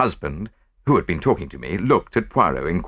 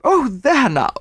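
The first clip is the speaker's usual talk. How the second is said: high, loud delighted gasp of a rich lady; excited exclamation; 5 dB louder, slower than usual. A narrator's reading. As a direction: burst out in delight. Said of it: "she exclaimed".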